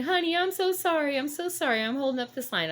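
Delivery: put-on high, fretful old lady saying sorry; an old lady flustered at holding up the line; apologetic old lady. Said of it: "one little old lady".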